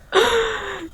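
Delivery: high, sharp fake gasp of shock; hammed-up little gasp; mock gasp. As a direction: gasp as if scandalised. Fake shocked reaction to article.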